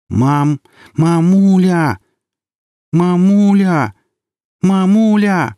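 slow, high, loud drawn-out calling. A narrator's reading. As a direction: call her mother back to waking. Daughter rouses unconscious mother.

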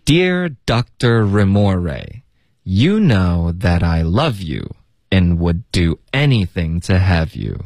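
slow, careful teacher's read-aloud; measured pace, "love you" linked; model reading. Shown as a demonstration. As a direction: read it out slowly for learners to follow. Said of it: "老师再来读两遍".

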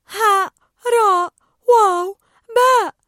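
slow, high, loud letter by letter; spelling slowly. Acted. Dory spells out the letters by the door, slowly. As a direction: sound out each letter carefully. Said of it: "ببطء".

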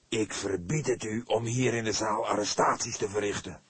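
a hint of a threatening old man; lightly voiced stern man. A narrator's reading. Man forbids arrests, threatening. (dreigende man, licht gespeeld)

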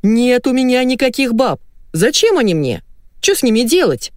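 high-pitched protest; mock wail. A narrator's reading. Husband denying other women to his wife, wailing. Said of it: "завопил Колян".

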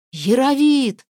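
loud desperate call; shouting a name. Acted. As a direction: yell the name for help. Calling out for help.

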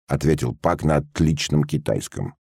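plain reading voice

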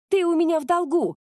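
high-pitched, forceful demand; narrated cry, insistent and high; urgent shout. A narrator's reading. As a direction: call it out sharply, pitch well up. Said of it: "крикнула Мика".

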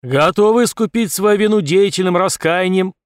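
loud parade-ground address; commanding general voice. Acted. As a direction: address the troops firmly. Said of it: "командным голосом".

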